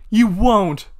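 loud, high outburst; a sudden shouted burst. Acted. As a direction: yell it with full force. Climax of acted story quarrel.

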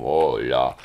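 low, hoarse hello showing a rough voice. Shown as a demonstration. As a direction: croak the greeting to show the hoarseness. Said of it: "Estoy un poquito ronco".

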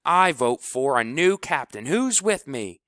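riled up. Acted. Kleebo turns the team against their captain, loud and riled. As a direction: stir the team against him.

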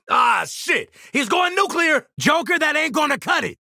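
very high-pitched urgent yell; alarmed shout. Acted. Alarmed at enemy going nuclear, urgent shout.

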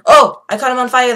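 loud, high yelp of surprise; excited shout. Real, not acted. Player sees opponent catch fire, excited shout. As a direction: burst out in sudden excitement.

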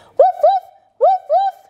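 high, loud yappy puppy woofs; puppy barks. Pretend. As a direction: bark like a playful little puppy. Puppy dog pose barks.

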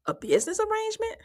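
high, sarcastic mock question; mocking high-pitched quote; mocking Martell. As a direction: say it like a scoffing imitation. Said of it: "He said".

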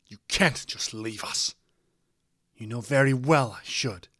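loud, insistent quarrel; heated arguing. A narrator's reading. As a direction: argue back forcefully, leaning on key words. Parents in a heated argument.

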